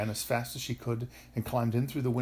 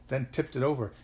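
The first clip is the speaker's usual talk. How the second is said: usual speaking voice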